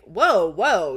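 loud, swooping mock objection; retold protest. Friend objecting to being handed the girl, loud retold protest. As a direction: cut in with an acted loud protest. Voicing Chandler objecting to Joey's offer.

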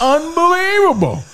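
high-pitched exasperated outburst; incredulous exclamation. Retold or imitated. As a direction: cry out at the outrage of it. Exclaims at dog's mess.

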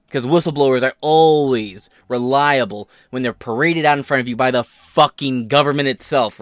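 normal talking voice

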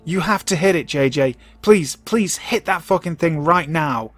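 acted screaming. A narrator's reading. Travis begging dad over the radio, screaming hysterically. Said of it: "He just keeps screaming".

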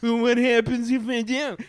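high, loud cheeky comeback; teen defiance. Retold or imitated. Teenager sassing his mom, loud mock defiance. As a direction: talk back like a defiant teen. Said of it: "I was like".

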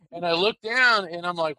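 ordinary talk

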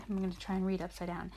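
ordinary delivery, nothing marked

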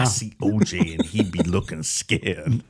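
put-on slow, lightly done Jamaican accent. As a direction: drawl it like a Jamaican caller. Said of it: "in this Jamaican accent".

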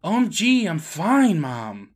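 put-on huffy defensive kid. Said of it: "he defensively says".

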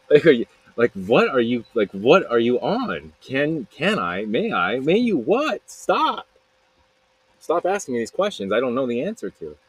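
excited rant with pitch leaping up. Real, not acted. Storyteller mocks her asking permission to kiss, excited. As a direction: get worked up, stretching the questions out. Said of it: "I get excited".

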